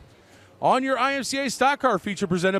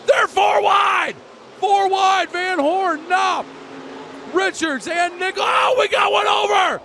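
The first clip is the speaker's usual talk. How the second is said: excited shouting; 8 semitones higher than usual. Real, not acted. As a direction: yell it as the cars go four wide. Car flips over.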